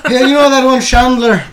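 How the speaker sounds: mock Scottish accent. Scottish drinker gossips about a sitcom character, mock Scottish. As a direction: ham up a gossiping Scot. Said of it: "start to speak in the Scottish accent".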